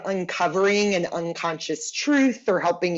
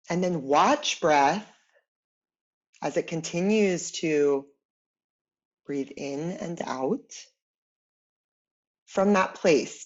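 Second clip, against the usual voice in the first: guided meditation; 3 semitones lower, 4 dB quieter than usual. Acted. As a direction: guide them gently inward, unhurried, letting each phrase settle. Leads guided imagery meditation.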